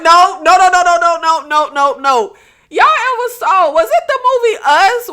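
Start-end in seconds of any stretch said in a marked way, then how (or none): 0.0-2.4 loud string of no's; panicked refusal. Retold or imitated. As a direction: push him away with frantic no's. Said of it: "she's just like".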